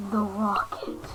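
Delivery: hushed whisper; quick awed whisper. Real, not acted. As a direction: whisper it as you spot it. Spotting the rocket.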